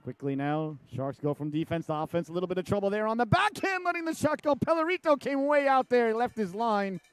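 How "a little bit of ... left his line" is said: high, louder rush of play-by-play; sudden high excited play call. Real, not acted. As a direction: jump up in pitch for the scoring chance. Shot and goalie rushes out.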